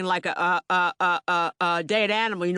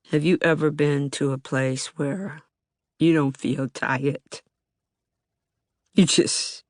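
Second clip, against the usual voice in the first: weary and worn down; 5 semitones lower than usual. Real, not acted. Telling how life in Baltimore wears you down, weary. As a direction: speak like someone worn out by it all.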